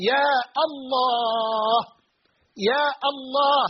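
يا الله with الله starting on a full "al", drawn out; article kept. Shown as a demonstration. Teacher showing the article stays after يا, sustained يا الله. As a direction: hold يا, then sound the "Allah" in full. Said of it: "لا نحذف الألف واللام".

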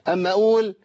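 ordinary talk, nothing marked

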